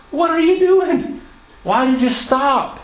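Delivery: a dying prophet baffled that the king quit so soon; exasperated rebuke. Retold or imitated. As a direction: cry out at him in disbelief for stopping.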